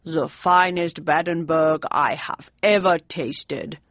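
lightly voiced German statesman, softened. A narrator's reading. Bismarck softening.

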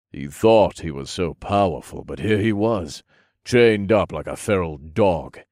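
ordinary delivery

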